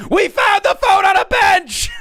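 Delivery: high, frantic yell; hammed-up exasperated shouting; desperate mock shout. Wife yelling translation into phone.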